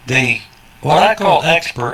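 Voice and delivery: usual speaking voice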